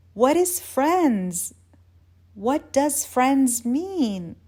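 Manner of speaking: woozy, stretched-out questioning; dazed bewilderment. Acted. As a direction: draw out each word, utterly baffled. Dazed woman questions basic word.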